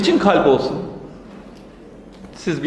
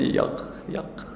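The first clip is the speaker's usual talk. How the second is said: breathy whispered answer, far softer; hushed refrain; 12 dB quieter than usual. Acted. Poet whispers the beloved's answer, hushed. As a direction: let the refrain fall to a whisper.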